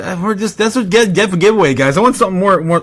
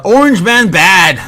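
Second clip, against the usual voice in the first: mocking slogan; 6 semitones higher, 9 dB louder, slower than usual.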